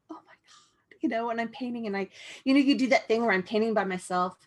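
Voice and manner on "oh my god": breathless unvoiced whisper of wonder; awed whisper. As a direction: whisper it to yourself, stunned by your own work. Awed self-talk while painting.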